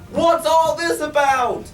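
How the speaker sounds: high, loud astonished call; shouting crowd. A narrator's reading. Crowd runs to see the elephant bird, high loud shout. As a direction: call it out in wonder. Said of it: "the people came shouting".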